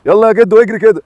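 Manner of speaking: loud call to the dog. Real, not acted. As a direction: shout it across the yard. Shooing dogs, calling out. (نداء عالي)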